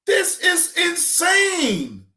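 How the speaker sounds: drawn-out, high exclamation of disbelief; incredulous. Real, not acted. Telling viewers about the interview, a slow, high, incredulous cry. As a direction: stretch it out, floored.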